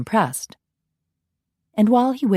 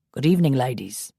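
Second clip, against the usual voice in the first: a touch lower, courtly Scottish greeting; lightly voiced Scot; 4 semitones lower than usual. Ian greeting the passing girls, lightly lower Scottish voice. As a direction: greet them like a gallant Highlander, lightly.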